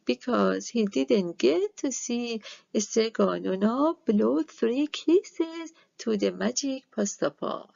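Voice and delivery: ordinary delivery, nothing marked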